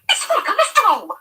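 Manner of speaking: high, loud shriek. A narrator's reading. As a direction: shriek the warning at him. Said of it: "Trish practically shrieks at Eddie".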